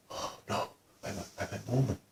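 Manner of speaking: barely audible, hesitant refusal; shy man almost whispering; quietly spoken. Retold or imitated. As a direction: imitate a very timid, soft voice. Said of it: "he was very quietly spoken".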